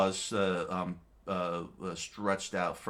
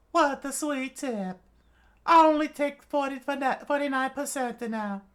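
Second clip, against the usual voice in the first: put-on old witch voice; 9 dB louder than usual. A narrator's reading. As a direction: talk like a sly old witch doctor.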